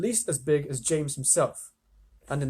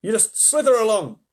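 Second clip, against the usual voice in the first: higher, louder jeering; mocking centipede; 7 semitones higher, 8 dB louder than usual. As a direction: sneer it out loud.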